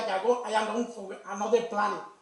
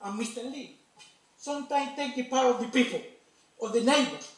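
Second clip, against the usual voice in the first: ordinary talk, nothing marked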